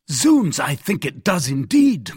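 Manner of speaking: high, loud exclamation; hearty, loud affirmation; emphatic cry. A narrator's reading. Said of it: "cried the Gascon".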